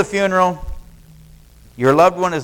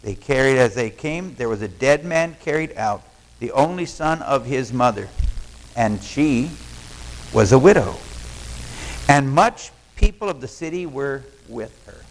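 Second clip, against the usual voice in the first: low solemn Bible reading; scripture reading; 6 semitones lower than usual. Acted. Reads scripture slowly.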